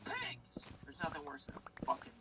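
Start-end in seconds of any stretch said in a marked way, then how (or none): 0.1-0.6 high squeal. Retold or imitated. High exclamation about police.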